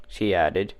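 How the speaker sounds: usual speaking voice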